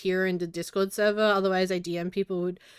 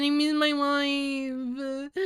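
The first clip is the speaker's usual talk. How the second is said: slow, drawn-out whiny lament, higher; hammed-up pining whine; mock wail; 5 semitones higher, much slower than usual. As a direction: stretch it out like a pining drama queen.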